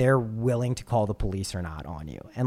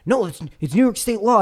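higher, louder stubborn stranger; retold stranger; 7 semitones higher, 6 dB louder than usual. Stranger insisting to Noah on the state law, higher and louder. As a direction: play him as a rule-citing busybody.

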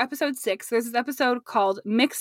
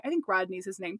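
ordinary talk

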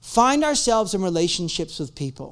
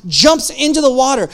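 ordinary talk

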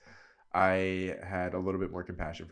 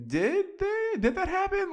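squeaky, much higher gasping question; shocked disbelief; 3 dB louder than usual. Retold or imitated. Reacting to the cast drama for viewers, shocked squeaky much higher question. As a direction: pitch shoots way up in stunned surprise. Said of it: "I'm like".

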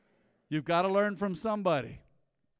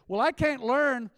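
loud, high, drawn-out objection; mock protest; 7 semitones higher, 7 dB louder, slower than usual. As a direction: imitate a stubborn objector, slowly and loudly. Mimics folks refusing to learn.

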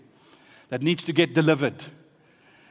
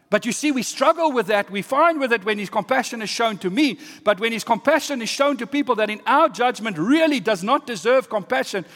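higher, driving preacher's delivery; emphatic preaching; 7 semitones higher, 4 dB louder than usual. Real, not acted. Preacher on struggling with compassion, emphatic. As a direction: press the point home with raised pitch.

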